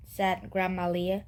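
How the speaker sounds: ordinary delivery, nothing marked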